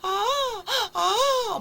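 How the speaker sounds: put-on high, loud open-mouthed baby wails; imitated baby crying. Shown as a demonstration. Teacher shows another sleepy baby's way of crying, imitated wailing. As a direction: bawl "ah" repeatedly like a tired baby. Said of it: "뭐 이렇게 울고".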